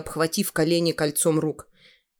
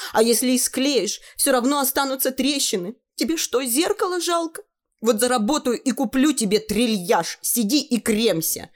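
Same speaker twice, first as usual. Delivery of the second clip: put-on girl's voice; 8 semitones higher than usual. Girl lecturing mother.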